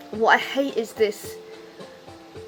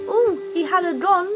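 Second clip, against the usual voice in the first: high, louder breathless fake shock; mock gasp; 7 semitones higher, 4 dB louder than usual. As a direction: gasp it like juicy gossip. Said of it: "If you're saying".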